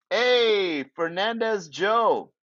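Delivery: ordinary delivery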